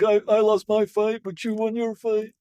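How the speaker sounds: lightly done weak, dying Rocky. Retold or imitated. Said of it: "that was my Rocky, like, dying cancer voice".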